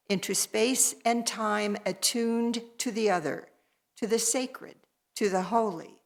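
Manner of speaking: measured, solemn invocation read to the congregation; call to worship. Acted. Minister calling the congregation into worship, solemn invocation. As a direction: lift the words as a liturgical opening, unhurried.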